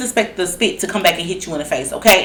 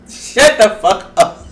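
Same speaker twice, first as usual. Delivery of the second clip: ordinary talk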